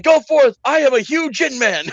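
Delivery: high, loud grand proclamation; pompous warlock. Retold or imitated. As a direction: proclaim it like a hammy wizard. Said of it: "it's like".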